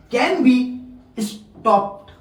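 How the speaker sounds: can be stop read very slowly word by word. Shown as a demonstration. Teacher reading the textbook line aloud to students, very slowly. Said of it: "इसको stop कर सकते हैं".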